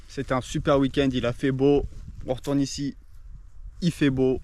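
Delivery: ordinary talk, nothing marked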